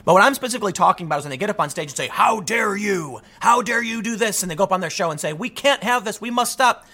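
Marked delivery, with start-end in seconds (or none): 2.1-4.4 hammed-up indignation, pitched higher; put-on righteous fury, drawn out at first; mock outrage. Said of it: "there's this big passion". 5.3-6.9 higher, put-on emotional plea; theatrical urgency, pitched up; mock passion. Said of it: "this big emotional outburst".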